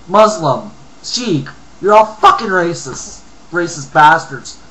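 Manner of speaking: loud, heated rant at immigrants; angry rant. Real, not acted. Reading an article, he vents a loud angry rant. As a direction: vent your fury, building to insults. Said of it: "I'm in a rant mode".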